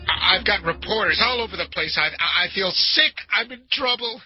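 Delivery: stammering plea that climbs in alarm; panicked plea. Acted. Caller leaves a friend a message begging help, rising panic.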